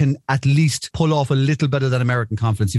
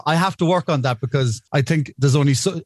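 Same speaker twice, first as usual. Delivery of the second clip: ordinary talk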